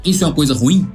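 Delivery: ordinary talk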